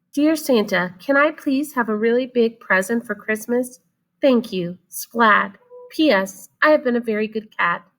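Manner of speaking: little cat reading his Santa letter; child cat voice. Acted. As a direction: read the letter like a hopeful kid.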